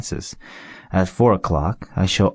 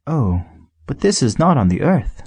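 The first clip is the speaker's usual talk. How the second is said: higher, eager correction; little prince; 7 semitones higher than usual. A narrator's reading. Little prince correcting the fox, eager higher voice. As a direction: correct the fox brightly, like a child. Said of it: "said the little prince".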